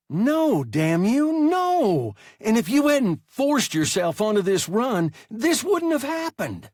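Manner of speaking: high, loud, furious outburst; a hurt boy yelling at his captain; angry boy's shout. A narrator's reading.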